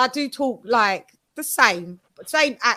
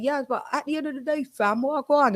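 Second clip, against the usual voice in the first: quick London street slang, softer; 4 semitones higher, 4 dB quieter than usual. Retold or imitated. Slipping into London slang with American friends. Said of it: "Sometimes I do slip up and I do sometimes like".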